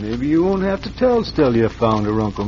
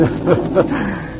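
short sly chuckle; 5 semitones higher than usual. Acted. Villain pleased after the killing.

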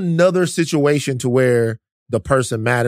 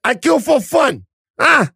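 put-on Jamaican movie-gangster accent; 7 semitones higher than usual. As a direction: ham up a Jamaican gangster from Belly. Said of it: "That's from Belly".

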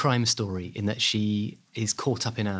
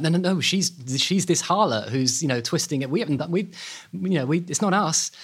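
indignant denial; 6 semitones higher than usual. Retold or imitated. Twins deny the fraud and blame Margaret, indignant protest. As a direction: protest your innocence, pitch climbing. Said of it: "And they're like".

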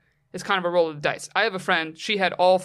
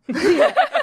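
high, loud laughing outburst; burst of laughter; 8 dB louder than usual. Real, not acted. Friend laughing at the doctor's reply, loud. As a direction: crack up.

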